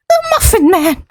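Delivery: squeaky high Muffin Man; high-pitched voice. Shown as a demonstration. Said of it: "hits the right area".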